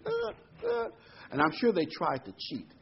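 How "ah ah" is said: high, squeaky croaking imitation of exhausted priests; tired frog croaks. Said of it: "sound like a bunch of frogs croaking".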